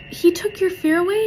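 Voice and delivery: high mom question; retold mom. Mom checks with her girl, imitated high question. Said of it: "she goes".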